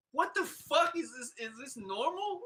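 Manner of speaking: retelling total bewilderment at a friend jumping; stunned disbelief. Retold or imitated. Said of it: "And I'm like".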